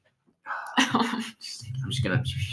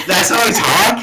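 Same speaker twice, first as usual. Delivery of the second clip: surprised; 11 semitones higher, 7 dB louder than usual. Real, not acted. Hearing own voice in headphones.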